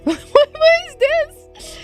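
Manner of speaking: usual speaking voice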